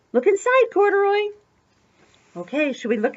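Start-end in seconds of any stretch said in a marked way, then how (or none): 0.1-1.3 high-pitched, excited prompt; playful high call; eager urging. Acted. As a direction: urge the storybook bear on eagerly. Acted urging of story character.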